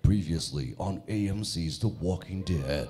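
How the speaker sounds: low, slow dramatic recap voice; deep slow voiceover; trailer voiceover. Shown as a demonstration. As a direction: intone it like a TV series recap. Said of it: "boses ... nagsasalita ng".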